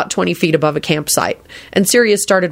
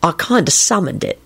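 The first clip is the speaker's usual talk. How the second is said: mildly sheepish confession; a touch bashful. A narrator's reading. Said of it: "he added sheepishly".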